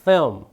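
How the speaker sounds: "film" as one falling "feom", L barely touched; dark L. Shown as a demonstration. Teacher repeating "film" for students, vague dark L. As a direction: let the L in "film" melt into a vowel. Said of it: "한 번 더요".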